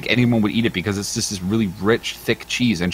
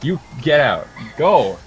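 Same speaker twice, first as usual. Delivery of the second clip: raised, high-pitched playful outrage; mock-outraged scolding; 5 semitones higher than usual. Streamer kicks out a tickling partner, mock-outraged scolding. Shooing away someone tickling them.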